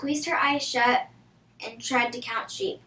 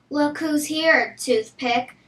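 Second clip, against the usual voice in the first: taunting; 4 semitones higher, 7 dB louder, slower than usual. Acted. Brenda taunts Jessica at lunch.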